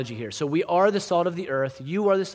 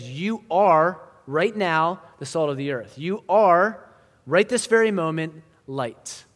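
"are" driven home loud and slow; slow, emphatic declaration, leaning hard on "are"; stress on ARE; 3 semitones higher, 6 dB louder, slower than usual. Retold or imitated. Jesus looking at his disciples, retold with loud stress on "are". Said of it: "I want to emphasize the second word here".

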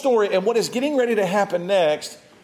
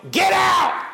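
much higher, louder shouted command; demonic yell; 13 semitones higher, 4 dB louder than usual. Retold or imitated. Spirit inside the house yelling at the preachers, shrill shout. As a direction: bark it like the devil inside. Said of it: "They yelled".

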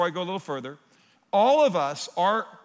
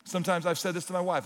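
ordinary delivery

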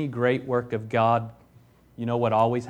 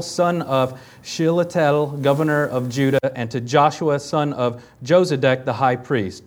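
formal, louder Bible reading; scripture reading; 3 semitones higher, 5 dB louder than usual. Acted. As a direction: read it out like scripture to a congregation. Reads scripture aloud.

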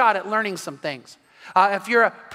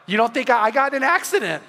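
laughing; 3 semitones higher, 4 dB louder, faster than usual. Real, not acted. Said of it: "laughs at himself".

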